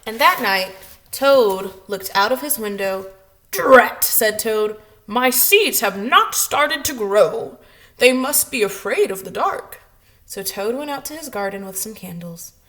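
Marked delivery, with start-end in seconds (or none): 3.5-9.8 annoyed. Acted. Toad frustrated at night.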